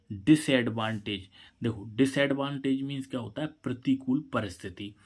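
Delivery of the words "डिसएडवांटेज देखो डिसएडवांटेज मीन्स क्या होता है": ordinary talk, nothing marked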